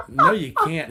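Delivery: very high, loud mock disbelief; hammed-up high-pitched protest; incredulous refusal. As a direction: exclaim it as the disbelieving doctor. Doctor refusing to let patient work.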